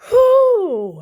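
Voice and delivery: loud high "whew" blowing off steam; heated exhale. Real, not acted. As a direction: let out a sharp breath to cool down. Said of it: "I gotta calm down".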